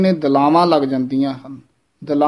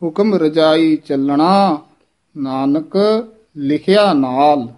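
measured line halting on Nanak; pause after word; slower than usual. Shown as a demonstration. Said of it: "Nanak ਪਦ ਤੇ ਵੀ ਯਮਕ".

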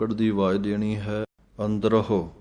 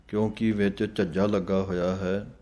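ordinary delivery

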